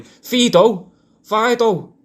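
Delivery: high, loud dog call; shouting. Shown as a demonstration. What people think he calls in the park, shouting. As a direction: holler the dog's name, pitch jumping up. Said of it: "shouting, "Fido, Fido, Fum".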